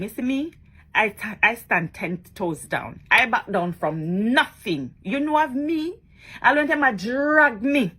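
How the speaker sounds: normal talking voice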